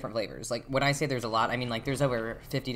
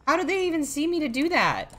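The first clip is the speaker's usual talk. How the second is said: panicked squeal; 6 dB louder than usual. Real, not acted. Panicked gameplay.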